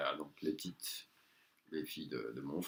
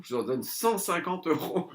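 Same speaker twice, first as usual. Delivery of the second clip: slow, higher put-on voice; mocking the stingy mother; 8 semitones higher, slower than usual. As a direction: spell the offer out like a haggler. Imite la mère radine. (imitation lente de la mère radine)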